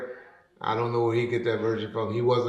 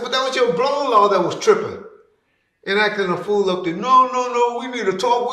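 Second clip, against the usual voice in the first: raised retort; 10 semitones higher, 4 dB louder than usual. Retold or imitated. Arguing with Ronald.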